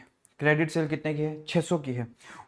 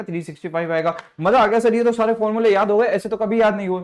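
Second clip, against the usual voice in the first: high, loud, excited student showing off; eager student voice; 7 semitones higher, 5 dB louder than usual. Acted. Teacher plays a thrilled student, high and eager. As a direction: play the keen pupil who already knows it.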